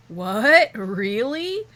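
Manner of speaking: loud, incredulous comeback; indignant disbelief. Acted. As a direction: throw it back loudly in disbelief. Acted indignant reply about light.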